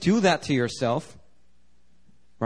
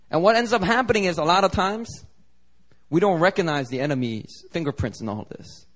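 normal talking voice

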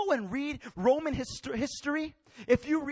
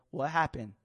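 ordinary delivery

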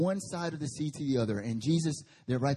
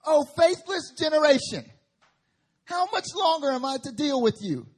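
loud, high exasperated rebuke; preacher voicing Jesus' loud lament; Jesus rebuking; 8 semitones higher, 6 dB louder than usual. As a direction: cry out in weary frustration.

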